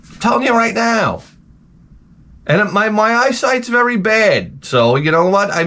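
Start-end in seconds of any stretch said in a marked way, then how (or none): 0.1-1.2 high, pointed protest to Home Depot; a mild mock showdown with Home Depot; defiant declaration. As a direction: lay down the law to the store. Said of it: "I'm like".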